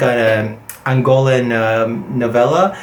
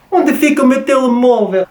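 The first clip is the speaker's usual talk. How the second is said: imitating a Portuguese speaker; 10 semitones higher than usual. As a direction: mimic the Lisbon phrase brightly. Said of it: "When I first heard this".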